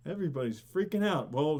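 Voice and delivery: ordinary talk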